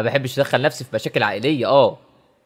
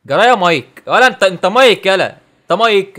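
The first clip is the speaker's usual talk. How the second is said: heated; 5 semitones higher, 8 dB louder than usual. Acted. Confronting scene partner heatedly.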